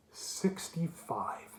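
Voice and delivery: slow whisper after a long pause, for effect; dramatic whisper. Real, not acted. A YouTuber finishing a dramatic pause for his viewers, whispering slowly. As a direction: breathe the number out slowly, letting it land. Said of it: "Dramatic pause for effect".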